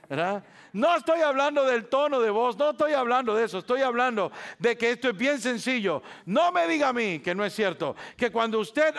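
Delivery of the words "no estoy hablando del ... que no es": high, loud, insistent; emphatic insistence. Real, not acted. Pastor clearing up a misunderstanding, insistent. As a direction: press the point hard.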